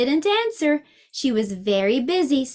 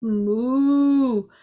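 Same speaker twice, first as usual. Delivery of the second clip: long, sustained mooing; cow's moo; 2 semitones higher, 4 dB louder than usual. A narrator's reading. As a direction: moo like a cow, drawing out the vowel. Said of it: "said the cow".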